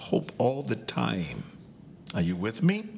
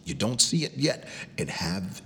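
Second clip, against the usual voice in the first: ordinary talk, nothing marked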